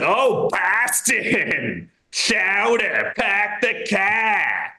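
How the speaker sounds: slow, hammed-up Boston voice mocking a tourist; dumb New England accent. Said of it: "start talking to you in a dumb New England accent".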